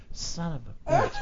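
slow, breath-only mock frustration; whispered curse. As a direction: curse slowly under your breath. Aside after being passed over.

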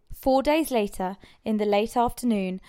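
usual speaking voice

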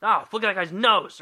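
high loud gasp of disgust at a nose; startled passer-by gawking at a nose; mock shocked onlooker. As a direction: recoil like a horrified stranger. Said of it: "Nobody looks at you and goes".

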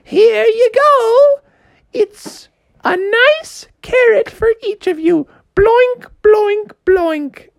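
put-on high crackly old lady handing out carrots. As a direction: dote on the kids like a granny. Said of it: "it was an old lady".

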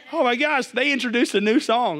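high, excited exclamation; acted thrilled reaction; mock rejoicing. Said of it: "I bet there's some rejoicing going on".

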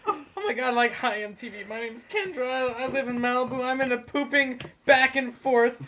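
put-on high Valley Girl voice; Valley Girl accent. Shown as a demonstration. Repeats the Valley Girl profile.

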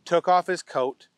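ordinary delivery

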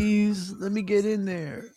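high, wheedling mock plea; mock pleading. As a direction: beg like a desperate hopeful, in jest. Imagined begging for cabinet post.

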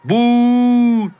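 long, high, loud drawn-out boo; crowd booing shout. Acted. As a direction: boo them as loud as you can. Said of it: "וצעקו". (צעקת בוז ארוכה, גבוהה וחזקה)

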